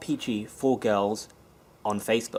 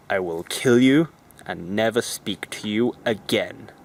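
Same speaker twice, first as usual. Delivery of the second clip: deadpan, slow, spelled-out threat; 2 semitones lower, 4 dB louder, slower than usual. Retold or imitated. A friend threatens his mate with slow deadpan words. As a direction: lay the threat out word by word. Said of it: "the other guy goes".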